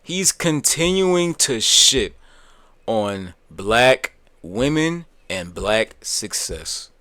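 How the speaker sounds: very drawn-out declaration with weighty pauses; slow, indignant emphasis. Real, not acted. Streamer repeating his charge to viewers, slow and emphatic.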